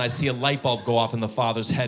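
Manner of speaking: usual speaking voice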